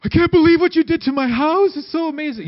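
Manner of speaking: high, loud gushing squeal of delight; gushing TV reveal. Retold or imitated. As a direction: play the overjoyed homeowner at the big reveal. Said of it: "those home renovation shows where they have".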